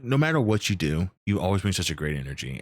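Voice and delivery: ordinary talk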